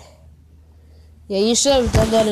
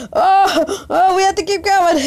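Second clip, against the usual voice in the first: being chased; 6 semitones higher than usual. Real, not acted. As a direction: yelp and urge them on. Chased, crying out.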